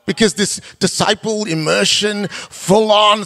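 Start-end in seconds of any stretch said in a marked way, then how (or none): none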